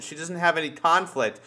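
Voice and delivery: ordinary talk, nothing marked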